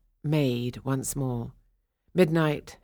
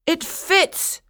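higher and louder joyful cry; excited cry; 14 semitones higher, 6 dB louder than usual. Acted. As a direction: shout it in delight. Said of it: "cried the prince".

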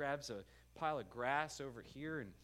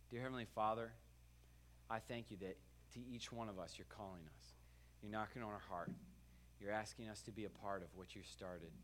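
prayer. Acted. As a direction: pray it gently, drawing the room in. Closing prayer over music.

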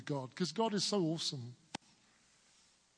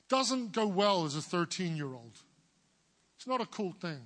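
ordinary delivery

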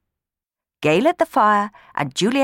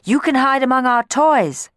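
eager; 9 semitones higher, 6 dB louder than usual. Acted. Children eager to help.

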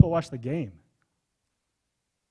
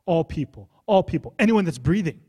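louder, higher insistence on "All people"; emphatic preaching; 4 semitones higher, 9 dB louder than usual. Acted. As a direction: hammer home "All people". Emphatic climax.

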